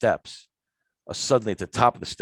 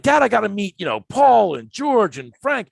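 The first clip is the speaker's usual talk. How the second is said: put-on teen pleading; 11 semitones higher, 6 dB louder than usual. Teen pleads with his dad to see friends, loud whine. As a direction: beg your dad to let you go. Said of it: "I'm like".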